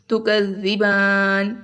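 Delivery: slow, last vowel of "زبان" held four counts; four-count elongation. Shown as a demonstration. Teacher showing a four-count stop, last vowel drawn out long. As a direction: draw out the aa in "زبان", close on n. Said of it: "اگر چار حرکت کے مرا-، برابر".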